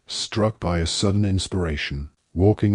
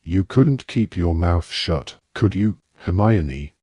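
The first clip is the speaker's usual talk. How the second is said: plain reading voice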